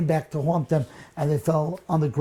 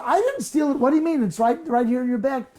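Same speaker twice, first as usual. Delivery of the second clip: high, shocked protest of innocence; indignant protest; 6 semitones higher, faster than usual. Acted. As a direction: cry out in disbelief that you're being accused. Benjamin protests his innocence.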